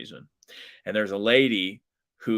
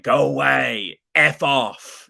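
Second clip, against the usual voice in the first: fed-up shooing; 4 semitones higher, 7 dB louder, slower than usual. Retold or imitated. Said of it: "he was polite for the first four times".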